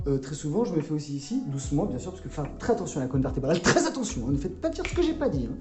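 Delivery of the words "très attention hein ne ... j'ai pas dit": high mock warning.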